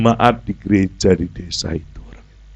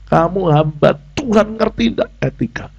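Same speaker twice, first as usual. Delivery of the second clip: higher-pitched reproach, not shouted; mildly indignant telling-off; retold scolding; 7 semitones higher than usual. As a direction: scold someone for lacking manners. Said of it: "wis tak bentak".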